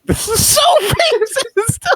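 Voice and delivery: squealing laugh, high and cracking; laughing outrage. Real, not acted.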